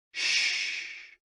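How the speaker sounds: drawn-out voiceless shh like a deep sigh; long sighed shh. Shown as a demonstration. Narrator demonstrates a healing sound for the liver, long sighed shh. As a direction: let a long shh hiss out on the breath. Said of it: "with a deep sigh".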